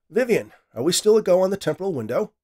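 high, loud and eager, calling out to the room. Acted. Scientist calls across the empty lab to his AI, eager and loud. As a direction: raise your voice, keen to begin.

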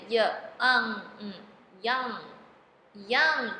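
put-on "young" split slowly into sounds with a separate ng, then blended; sounding out "young". Shown as a demonstration. Teacher showing learners NG sound, sounded out then blended. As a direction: sound out "young", humming the final ng alone first. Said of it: "N G发嗯".

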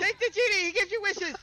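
loud, high, laughing; laughing shout. Real, not acted.

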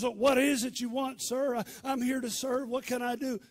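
high, keen offer of help; eager servant. Retold or imitated. Boy asks his master what he wants, eager to serve. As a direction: report for duty, loud at first.

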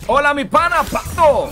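voz aguda y fuerte de personaje; saludo con voz aguda. Pretend. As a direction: saluda a Pato en broma con otra voz. (saludo juguetón a Pato con voz aguda)

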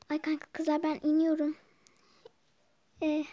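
normal talking voice

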